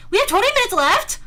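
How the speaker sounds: high, loud incredulous question; shocked disbelief. Real, not acted. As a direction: blurt it out as it dawns on you. Realizes the time, repeats it shocked.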